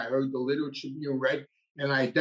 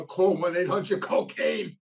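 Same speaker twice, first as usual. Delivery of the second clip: raised voice, a mild retold urgency; lightly acted plea, pitch climbing at the end; retold desperate plea; 3 semitones higher, slower than usual. Begging his wife to call the cocaine helpline, mildly desperate.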